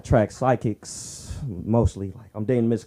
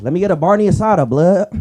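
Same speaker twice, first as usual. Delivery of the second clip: mock gangster order; 7 semitones higher, 11 dB louder than usual. Gangster ordering at taco truck.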